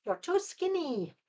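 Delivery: high, soft mock Italian grandma; lightly hammed-up Italian old lady, pitched high. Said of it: "you've got the old lady who's like".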